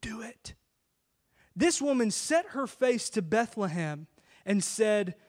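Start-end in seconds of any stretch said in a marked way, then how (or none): 0.0-0.5 a soft, hushed "Do it"; quiet whispered nudge; hushed divine whisper. Retold or imitated. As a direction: breathe it like a still small voice. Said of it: "no whisper in the still small voice".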